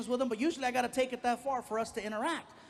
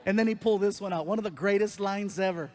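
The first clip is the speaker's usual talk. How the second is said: ordinary talk, nothing marked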